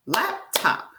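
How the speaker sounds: "Laptop" broken into two beats; "Laptop" syllable by syllable, for a learner; split into syllables. Shown as a demonstration. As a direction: say "Laptop" as lap, pause, top. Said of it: "Can you try to clap out laptop?".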